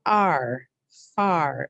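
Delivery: ar sounded apart, then far; sounding out far. Shown as a demonstration. Teacher repeats the sounds of far for the class. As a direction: split far into ar and far, firmly. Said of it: "we use the A R to spell R".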